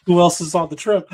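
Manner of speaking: high, laughing question. Real, not acted. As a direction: ask it while still laughing. Laughing at the joke.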